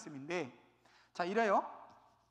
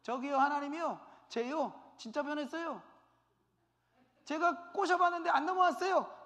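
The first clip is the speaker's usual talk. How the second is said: eager testimony; 6 semitones higher, 4 dB louder than usual. Retold or imitated. Accomplice testifying before God.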